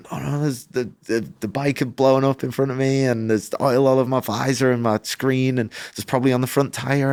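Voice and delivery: high, frantic rush of words; panicked rider pleading with the crew; freaking out. Retold or imitated. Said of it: "I'm freaking out".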